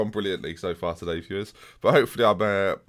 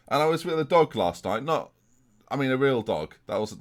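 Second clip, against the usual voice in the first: normal talking voice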